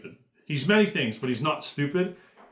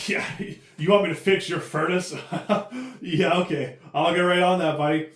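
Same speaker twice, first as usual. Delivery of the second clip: laughing sneer, higher and louder; mocking laugh; 5 semitones higher, 3 dB louder than usual. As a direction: laugh at the customer while agreeing. Imitating a mocking furnace repairman.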